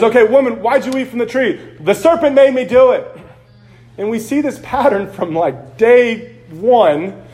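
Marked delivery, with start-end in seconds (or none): 0.0-1.6 raised, high-pitched confrontation; acted questioning. 1.8-3.1 high, loud mock excuse; mock blame-shifting. Eve shifting blame in story.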